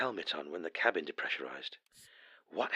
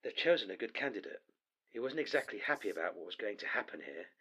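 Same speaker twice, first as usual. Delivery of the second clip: usual speaking voice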